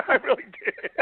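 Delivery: laughing through the words; high, laughing admission. Real, not acted. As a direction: chuckle as you say it. Laugh heard on line.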